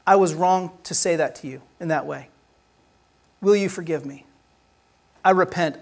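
soft, humble confession to a family member; a quiet plea for forgiveness; sincere apology. Shown as a demonstration. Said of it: "It sounds like this in a family".

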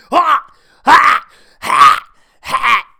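high, loud huffing "ha" bursts; mock heaving "ha, ha, ha"; dog's retching imitation. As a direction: imitate a dog gagging with sharp breathy huffs. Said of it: "how he's going".

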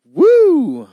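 high, loud whoop. Real, not acted. Co-host breaking the lull, high whoop. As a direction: let out a sudden high woo. Exclaims after a long pause.

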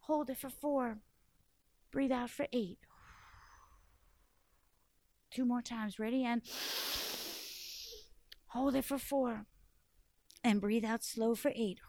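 soft, calm breathing guide; coaching the children through four-four-eight breaths. Shown as a demonstration. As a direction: lead the breath gently and quietly. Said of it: "something you should always do when you're that mad".

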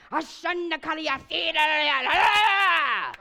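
high, slow, shouted tongues prayer; screaming in tongues. Retold or imitated. Pastor's wife screaming tongues over the girl, high shout. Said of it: "Screaming at her".

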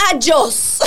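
mock yelled send-off; retold shouted farewell. Vendor dismissing a customer who won't buy, mock shout. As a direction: imitate the furious vendor's dismissal, half laughing.

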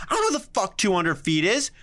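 ordinary delivery

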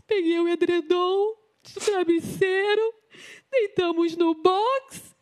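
fighting back tears while telling it; voice breaking into tears. Real, not acted.

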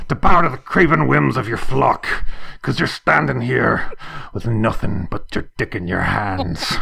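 hammed-up fire-and-brimstone rant at a church meeting; Liam Neeson impression. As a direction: thunder at the church board like an angry Liam Neeson. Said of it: "Liam Neeson at a church business meeting".

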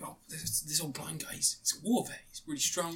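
hushed, frightened whisper; scared whisper. Retold or imitated. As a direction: whisper as if hiding from him. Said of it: "these three robbers are like".

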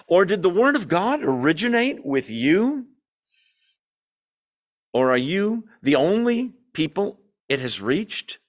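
pointed sarcastic questions with swooping pitch. Acted. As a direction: read it as mock-incredulous questioning. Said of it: "gonna get a little sarcastic".

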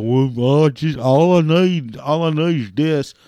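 high, loud, put-on pleading; mocking whiny caller voice; mock whining customer. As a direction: imitate a caller protesting over and over. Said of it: "So don't even get all".

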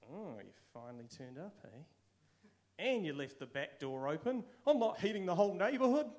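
the fed-up dad who starts snide, then blows up; sarcastic dad building to a loud complaint. Retold or imitated. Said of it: "the sarcasm voice".

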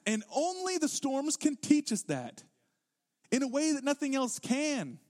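high, emphatic pulpit delivery; impassioned preaching. Real, not acted. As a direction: preach it with rising fervour, pitched well up. Preaches at full force.

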